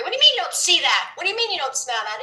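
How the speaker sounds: fast, high, exasperated disbelief; mock-angry at friends who don't see it; retold anger. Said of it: "Used to get angry".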